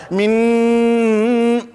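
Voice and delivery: "Min-" with the n held as a long hum; nasal gunna. Shown as a demonstration. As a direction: hum through the nose on the end of "Min-". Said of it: "em árabe chama-se gunna".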